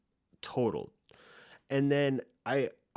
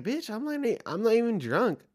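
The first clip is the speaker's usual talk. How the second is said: high, indignant squeal of protest; retorting to the neighbour girl, high and outraged; 12 semitones higher, 4 dB louder than usual. Retold or imitated. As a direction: shoot it back at her much higher, offended. Retold indignant reply to girl.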